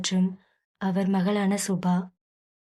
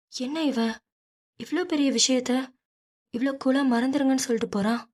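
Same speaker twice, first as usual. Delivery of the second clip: puzzled mother; 4 semitones higher, faster than usual. A narrator's reading.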